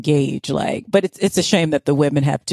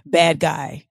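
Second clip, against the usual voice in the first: ordinary talk, nothing marked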